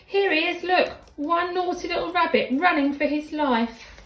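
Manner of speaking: high, loud breathless refrain; excited refrain. A narrator's reading. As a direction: call it out like a chase. Refrain during the chase.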